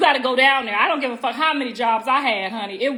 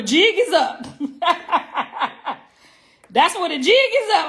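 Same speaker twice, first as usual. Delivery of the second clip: laughing; 5 semitones higher than usual. Real, not acted. Laughs at own joke.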